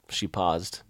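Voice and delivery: ordinary delivery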